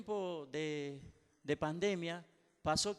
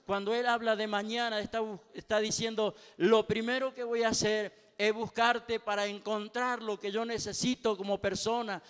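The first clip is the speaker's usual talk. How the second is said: rising sermon, louder and louder toward a cry; fiery preaching; 2 semitones higher, 7 dB louder than usual. Acted. Pastor exhorting the congregation to seek God, building to full-voiced preaching. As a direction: preach it with growing fire.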